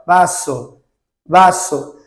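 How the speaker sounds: vaso said as baso; Spanish vaso. Shown as a demonstration. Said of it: "Tô repetindo aqui no microfone".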